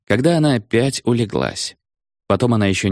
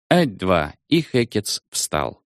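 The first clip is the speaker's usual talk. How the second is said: ordinary delivery, nothing marked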